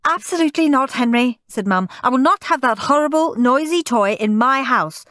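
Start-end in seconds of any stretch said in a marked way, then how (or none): 0.1-1.4 high, scandalised mum; exasperated mother laying down the law; firm refusal. A narrator's reading. Mother firmly refuses noisy toy. 2.0-5.0 high, brisk scolding; exasperated, raised-pitch mother; firm refusal. A narrator's reading. Mother firmly refuses noisy toy.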